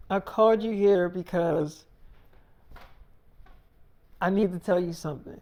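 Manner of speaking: sigh, then stall with a long pause; acting out a reluctant daughter working up to news; imitated nervous lead-in, halting and subdued; hesitant confession. Daughter hesitates before confessing to mom.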